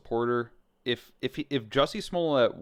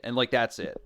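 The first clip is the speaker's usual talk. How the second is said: ordinary talk, nothing marked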